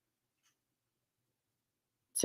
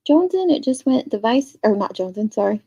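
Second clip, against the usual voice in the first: usual speaking voice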